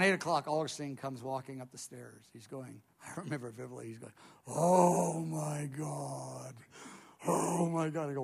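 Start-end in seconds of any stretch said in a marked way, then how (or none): 4.5-8.1 drawn-out, stunned "oh my God"; imitating someone overwhelmed, very slowly; long, heavy exhalation of awe; awestruck friend. Friend voiced coming upstairs stunned.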